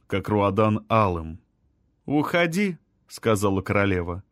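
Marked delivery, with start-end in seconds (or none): 2.1-2.8 high, cool dismissal; commanding queen. A narrator's reading. Queen orders Руадан to leave, regal. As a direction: send him away without raising volume.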